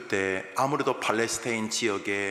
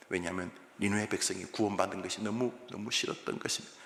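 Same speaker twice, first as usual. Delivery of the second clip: ordinary delivery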